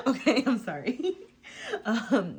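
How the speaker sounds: words breaking into real laughter; laughing. Real, not acted. Reading cards for viewers, laughing through it.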